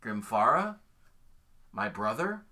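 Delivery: surprised question. Acted. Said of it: "raises an eye and goes".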